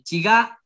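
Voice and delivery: "chigá" stressed on the last syllable, GÁ; stress on final syllable. Shown as a demonstration. Student asking teacher about accent, final-syllable stress. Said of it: "el acento va al final".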